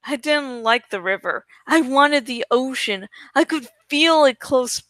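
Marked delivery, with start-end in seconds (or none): none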